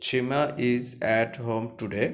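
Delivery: each word said slowly and clearly; slow classroom dictation; slow reading. Shown as a demonstration. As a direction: read it out slowly for students to follow. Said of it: "teacher reads sentence slowly".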